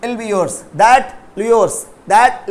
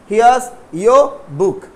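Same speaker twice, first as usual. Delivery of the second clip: here's your book spelled out slowly; teacher modelling slowly, word by word; slow model sentence; much slower than usual. Shown as a demonstration. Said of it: "okay". (धीरे धीरे, here's your book)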